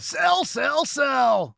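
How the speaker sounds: high mock announcer yelling sell; a hint of a frantic broadcaster shouting sell; Jim Comer voice. Host mimicking a broadcaster urging the Dodgers to sell, high yell. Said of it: "That was my Jim Comer voice right there".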